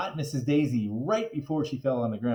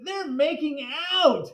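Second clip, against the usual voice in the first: grossed out; 14 semitones higher, 4 dB louder, slower than usual. A narrator's reading. Kid grossed out by kiss.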